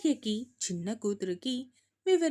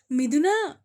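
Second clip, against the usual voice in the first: louder, higher call; 5 semitones higher, 9 dB louder than usual. A narrator's reading. Calling her daughter-in-law again, higher and louder. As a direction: stretch the name out, higher and louder. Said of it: "ఎందుకంత గట్టిగా అరుస్తున్నావ్".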